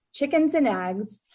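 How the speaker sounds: ordinary talk, nothing marked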